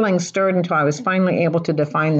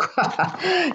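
high laugh; 13 semitones higher, 4 dB quieter than usual. Real, not acted. Laughing at herself.

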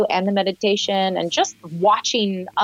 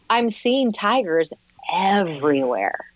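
ordinary talk

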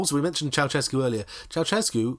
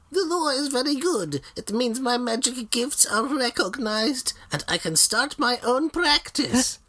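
high, slow witch voice; a lightly voiced old witch; 9 semitones higher, slower than usual. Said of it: "One witch said".

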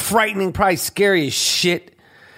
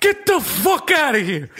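Danny Aiello impression; 7 semitones higher than usual. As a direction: play Danny Aiello chewing out tourists. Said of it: "I'm doing my Danny Aiello".

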